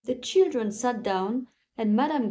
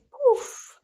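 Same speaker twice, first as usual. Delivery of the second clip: high, loud burst; magic poof; 14 semitones higher, 6 dB louder than usual. A narrator's reading. Narrator bursting out the spell's poof. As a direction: make the spell go off.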